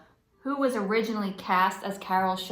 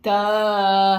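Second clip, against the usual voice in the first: sarcastic duh; 13 dB louder than usual. Real, not acted. Kid mocks obvious fact.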